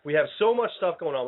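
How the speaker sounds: ordinary talk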